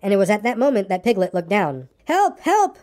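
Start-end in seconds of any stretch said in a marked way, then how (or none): 2.0-2.8 high-pitched cry for help; panicked cry. A narrator's reading. Piglet spots a heffalump in the pit, panicked high cry. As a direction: squeal it out as a frightened little piglet. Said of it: "cried Piglet".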